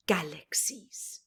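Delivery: usual speaking voice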